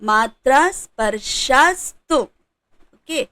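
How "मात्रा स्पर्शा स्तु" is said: मात्रा स्पर्शा स्तु said slowly, word by word; slow recap. Shown as a demonstration. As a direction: read the phrase slowly and clearly. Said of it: "recaps phrase". (धीमा पाठ)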